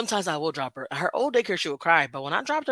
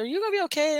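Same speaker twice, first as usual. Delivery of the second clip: high, soft caring therapist voice; a light mock of a worried therapist; imitating therapist; 10 semitones higher, 3 dB quieter, faster than usual. Therapist checking on client before her vacation, high and soft.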